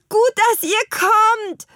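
high, loud, drawn-out character call. A narrator's reading. Petronella greeting her helpers on the roof, high loud call. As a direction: call out high over the wind. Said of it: "rief Petronella".

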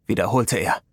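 usual speaking voice

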